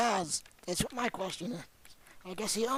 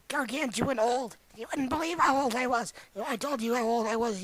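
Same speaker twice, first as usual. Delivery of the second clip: ordinary talk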